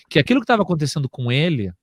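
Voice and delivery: usual speaking voice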